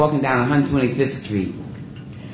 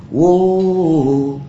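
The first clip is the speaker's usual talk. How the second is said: drawn-out, louder stage call "whoa"; mock singer's call; 4 semitones higher, 4 dB louder than usual. Singer holding the mic to the audience, drawn-out mock call. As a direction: call out like a showman working the crowd. Singer [name] calls to the crowd.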